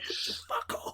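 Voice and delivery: breathless laughing whisper; whispered fuck off. Retold or imitated. Characters telling the radio guy off in a laughing whisper. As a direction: mouth it through a laugh. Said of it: "they're like".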